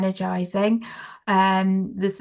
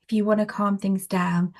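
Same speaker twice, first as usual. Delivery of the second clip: ordinary talk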